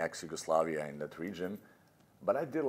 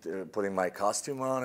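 normal talking voice

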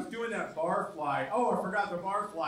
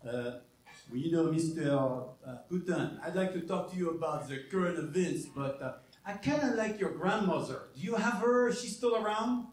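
Macron; 3 semitones lower than usual. Retold or imitated. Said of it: "Macron is on the line too".

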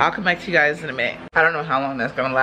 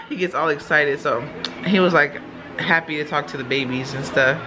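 ordinary talk, nothing marked

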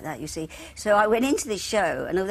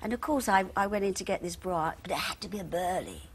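ordinary talk, nothing marked

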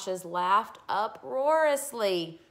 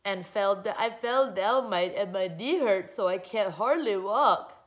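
put-on whiny crybaby child, slightly raised; sobbing; 5 dB louder than usual. A narrator's reading. Girl with a hurt knee, tearful whining. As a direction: blubber it out in hammed-up misery. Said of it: "Melody sobbed".

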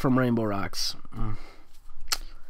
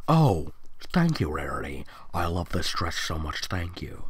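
lower, softer, half-whispered thanks; soft grateful Sunset; 8 semitones lower, 5 dB quieter than usual. Acted. Sunset hugging Rarity, thanking her in a low soft whisper. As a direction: whisper your thanks into the hug.

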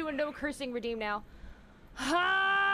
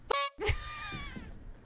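high squeaky laugh; 5 dB quieter than usual. Real, not acted. Laughing at own mistake.

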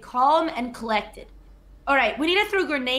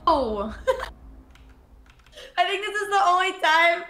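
laughing gasp; 6 semitones higher than usual. Real, not acted. Reacts to death laughing.

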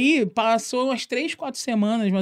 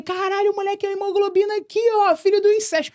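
much higher, gleeful jeering call; excited taunt; 12 semitones higher than usual. Retold or imitated. Kid branding the boy with a nickname, high gleeful jeer. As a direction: announce the nickname with glee.

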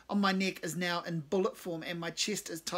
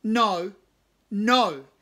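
louder no, pitched up; loud no rising to a final yell; 5 semitones higher, 12 dB louder, much slower than usual. Acted. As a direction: keep shouting it down. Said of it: "and then louder again".

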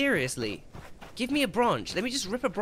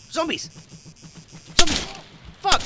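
high loud panicked yell; alarm shout; 12 semitones higher, 9 dB louder than usual. Real, not acted. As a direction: scream the warning. Zombies attack.

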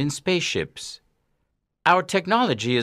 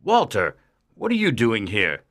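low-voiced mild surprise; surprised; 8 semitones lower than usual. A narrator's reading. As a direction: ask in a deep character voice, a touch surprised.

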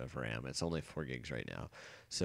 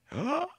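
high startled gasp; sharp gasp; 7 dB louder than usual. Real, not acted. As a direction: catch your breath in fright. Near fall in game.

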